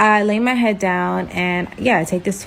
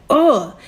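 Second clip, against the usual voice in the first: a short, disgusted mock "ugh", a bit louder; 4 semitones higher, 4 dB louder than usual. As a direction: spit out the ugh like she's grossed out. Imitating her disgusted face.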